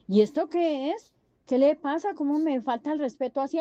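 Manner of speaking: lightly acted jealous husband blowing up; indignant husband. Husband catches wife in joke.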